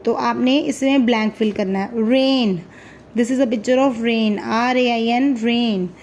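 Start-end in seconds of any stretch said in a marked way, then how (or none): none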